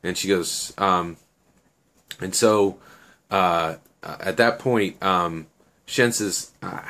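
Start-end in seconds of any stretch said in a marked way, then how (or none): none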